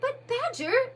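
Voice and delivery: high Mole voice, half-hearted whisper; voiced mock whisper. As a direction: Mole pleads with Badger, pitched high. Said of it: "it whispered".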